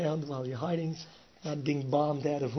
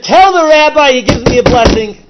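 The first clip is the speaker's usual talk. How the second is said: high, loud insistent voice; a high, loud demand for the blessing; 9 semitones higher, 23 dB louder than usual. Retold or imitated. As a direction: order it across the room. Mafia guy demands a blessing.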